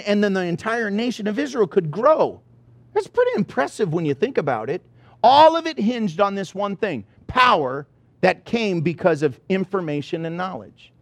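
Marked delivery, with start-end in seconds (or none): none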